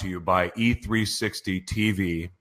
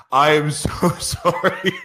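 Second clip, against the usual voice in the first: laughing apology; 5 semitones higher, slower than usual. Real, not acted. Laughing at own flubbed intro.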